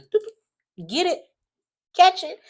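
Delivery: high, playful tossing call; mock lifeline toss. Detective tossing the daughter a lifeline, high and playful. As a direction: call it like throwing a rope. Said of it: "throwing that lifeline out to her".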